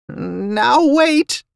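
high, pleading djinn voice; alarmed djinn. A narrator's reading. Djinn to the man stalking him, alarmed. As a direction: plead for time, pitched very high. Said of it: "putting his hands up as I began to stalk him".

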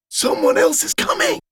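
urgent warning whisper. Acted. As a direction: breathe it out in alarm. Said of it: "Roger whispered".

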